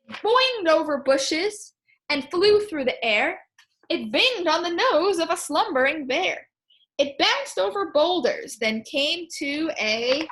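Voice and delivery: lively rhyming read-aloud; storybook read-aloud. Acted. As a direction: read the poem to the class.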